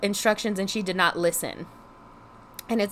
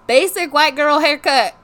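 mock outburst; 5 semitones higher, 8 dB louder than usual. Real, not acted. Mocking her own haircut.

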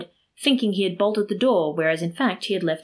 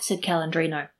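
ordinary delivery

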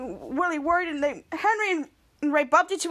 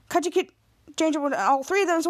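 usual speaking voice

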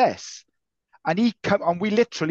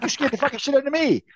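higher, fast, a startled stranger's voice; imitating a startled man; 8 semitones higher, faster than usual. A man startled by a stranger at the van, high and fast. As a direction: blurt it out like the scared guy. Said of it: "And he was like".

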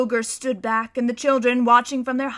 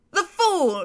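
high, loud scornful cry; witch crying out; 7 semitones higher, 5 dB louder than usual. Acted. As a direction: spit it out with contempt. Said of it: "she cried".

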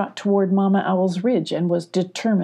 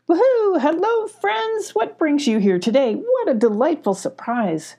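Mama Owl hooting greeting; 11 semitones higher, 3 dB louder than usual. Acted. Mama Owl greeting visiting friends, high and delighted. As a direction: sing out the greeting like a cheery owl. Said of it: "greeted Mama Owl".

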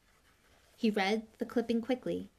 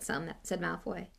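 usual speaking voice

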